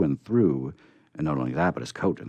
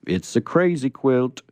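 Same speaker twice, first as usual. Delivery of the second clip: scarecrow voice; 6 semitones higher, 7 dB louder than usual. Acted. Scarecrow answering.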